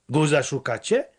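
ordinary delivery

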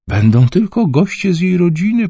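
high, wailing complaint; moaning. Acted. Anxious mother moans to the family. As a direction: whine it like a fretting mother. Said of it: "pojękiwała".

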